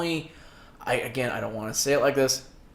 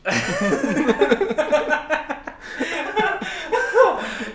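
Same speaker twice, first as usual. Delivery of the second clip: big laugh; 12 semitones higher than usual. Real, not acted. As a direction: crack up at the joke. Burst of laughter at joke.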